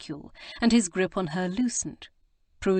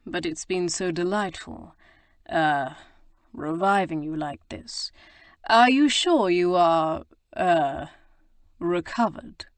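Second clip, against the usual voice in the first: provocative purr; 4 dB louder than usual. A narrator's reading. Rake teasing the lady he revived, provocative purr. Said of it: "he purred provocatively".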